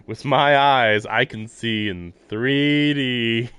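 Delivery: slow, loud, hammed-up hero voice; mock-heroic boast. Joking about Shiki's eyes, a slow, loud mock-heroic boast. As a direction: declaim it like an anime hero, slowly.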